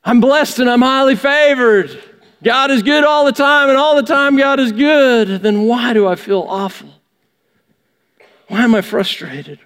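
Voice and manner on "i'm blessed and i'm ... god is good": higher, sing-song stock phrases; mock pious reply. Believer hiding pain behind churchy phrases, sing-song. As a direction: parrot the blessed answer with irony. Hollow churchy answer repeated.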